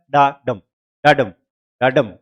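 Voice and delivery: weak डा, stronger stress on डम. Shown as a demonstration. Teacher showing students an iambic foot, डा unstressed, डम stressed. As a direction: tap out the iamb, light on डा and leaning on डम. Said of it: "डा पे stress कम दे रहे हो आप और डम पे ज्यादा".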